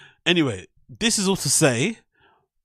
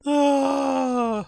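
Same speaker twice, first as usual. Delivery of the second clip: high mock wail; mock cry; 11 semitones higher than usual. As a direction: fake a short whimpering cry. Mocking the crying comedian.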